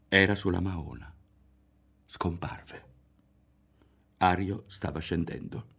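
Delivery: usual speaking voice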